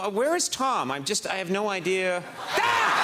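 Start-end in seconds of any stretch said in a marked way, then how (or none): 2.6-3.0 high mock shriek of fright; mock startled scream. Host startled by creepy Tom Cruise statue, mock shriek. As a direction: shriek like the statue just jumped at you.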